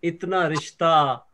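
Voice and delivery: slow, lingering verse with stretched words; ghazal recitation. Acted. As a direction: present each half-line to the mushaira audience. Poet recites ghazal opening.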